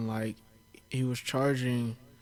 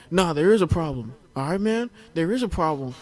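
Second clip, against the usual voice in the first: louder and higher, mock confrontational; loud, high, hammed-up anger; mock angry customer; 5 semitones higher, 8 dB louder than usual. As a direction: push back hard like the rude customer. Retold valet customer confronting.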